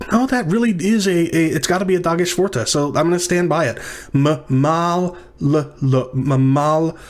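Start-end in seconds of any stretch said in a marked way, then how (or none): 4.1-7.0 Mimalot broken up as M-mal-l-ot, each syllable pulled apart; spelled out by syllable. Shown as a demonstration. Teacher splitting Mimalot into syllables for students, drawn out. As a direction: sound out Mimalot one syllable at a time with the L held long. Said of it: "four syllables".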